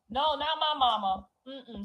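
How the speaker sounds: usual speaking voice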